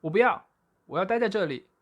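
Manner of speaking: put-on high, loud childish shout; loud protest. A narrator's reading. Boy refusing to leave, high loud shout. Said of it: "大声叫".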